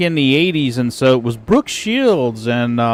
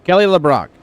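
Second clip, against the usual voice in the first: usual speaking voice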